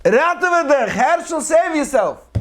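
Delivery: high, loud cry to save his son; father's desperate yell. Retold or imitated. Father in the fire yelling to his son to flee, desperate high shout. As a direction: scream the son's name as flames shoot out. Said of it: "my father yelled".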